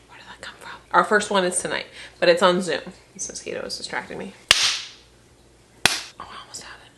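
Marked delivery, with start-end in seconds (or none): 0.1-0.7 whisper. Real, not acted. Whispering about a mosquito nearby. 3.1-6.9 whisper. Real, not acted. Quietly swatting at mosquito.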